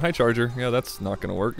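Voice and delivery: normal talking voice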